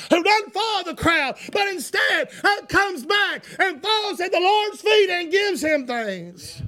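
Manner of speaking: loud, high pleading cry to the Lord; shouting leper's prayer. Acted. Healed leper crying out his prayer, loud and high. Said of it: "And he said".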